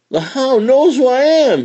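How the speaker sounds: high, loud fox call; shouting. Acted. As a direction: shout it while running off. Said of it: "he shouted".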